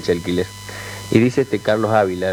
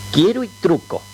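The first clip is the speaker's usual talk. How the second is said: coy and insinuating, pitched up; flirtatious; 7 semitones higher than usual. Retold or imitated. Wife answering the passing stranger with coy insinuation. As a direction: purr it teasingly at the stranger. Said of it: "con toda la gracia y, insinuante le dice".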